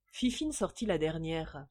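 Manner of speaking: ordinary delivery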